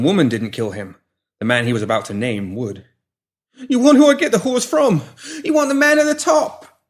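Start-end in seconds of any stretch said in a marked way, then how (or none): 3.7-6.8 much higher, louder frantic begging; panicked pleading. Acted. Pinky pleading with the woman holding shears, panicked. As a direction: beg for your life, backing away. Pinky desperately offers a name.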